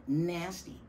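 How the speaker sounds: ordinary delivery, nothing marked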